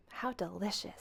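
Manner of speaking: hushed. Acted. Maggie savouring pie.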